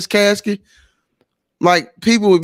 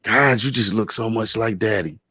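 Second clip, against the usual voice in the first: softer, lower mock-tearful sister; imitating crying sister; 6 semitones lower, 6 dB quieter than usual. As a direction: retell her tearful words lightly. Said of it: "she just bust out crying".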